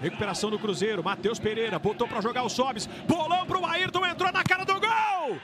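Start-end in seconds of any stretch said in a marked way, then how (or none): 2.9-5.4 high rising excited call; excited build-up. Real, not acted. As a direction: climb up as the striker gets through. Chance before a goal.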